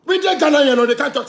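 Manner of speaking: high, loud outburst; shouted rant. Real, not acted. Ranting at celebrities, loud and high-pitched. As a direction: throw it out in anger.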